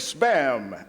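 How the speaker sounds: normal talking voice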